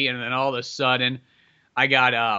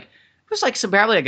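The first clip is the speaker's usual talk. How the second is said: ordinary talk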